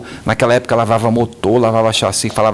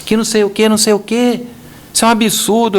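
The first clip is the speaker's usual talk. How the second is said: grito imitado, bem mais agudo; gritando imitado; 10 semitones higher than usual. Retold or imitated. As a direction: imitando o cliente que berra ao telefone. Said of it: "E o cara gritando, falando alto". (cliente gritando indignado ao telefone, imitado)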